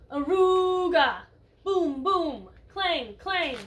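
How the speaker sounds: booming Aruga, boom, clang called out loud; loud noise sounds. Acted. Teacher reading to kids, booming the mystery noise. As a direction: belt out the noises like a horn and drum.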